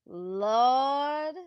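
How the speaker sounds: ordinary talk, nothing marked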